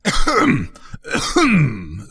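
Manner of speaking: mock cough to interrupt; pointed "ahem". A narrator's reading. Rocket tries to break in, pointed ahem. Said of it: "and he began to cough".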